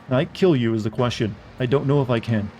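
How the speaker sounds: normal talking voice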